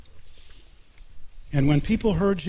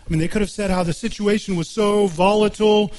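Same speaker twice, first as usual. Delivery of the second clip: raised, driving sermon voice; fervent preaching; 4 semitones higher than usual. Acted. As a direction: build the case with rising fervor. Preacher builds to fervent pitch.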